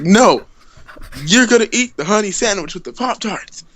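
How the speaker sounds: firm mock order, voice jumping up; bossy insistence. Pooh ordering the storyteller to eat, bossy.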